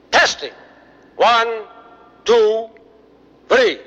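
loud mic check. Acted. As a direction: call out the count for the sound check. Mic check before the show.

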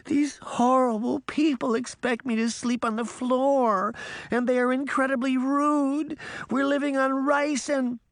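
put-on very high, sustained childish whine; high-pitched whiny crying out. A narrator's reading. Pinocchio complains of horrible people to his father, crying out. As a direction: pour out grievances in a shrill boy's wail. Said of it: "Pinocchio cried".

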